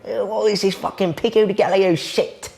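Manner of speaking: high-pitched, hammed-up British accent; mock British accent. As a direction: play a baffled Brit in a put-on British accent. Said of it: "Now try to do Mexican accent".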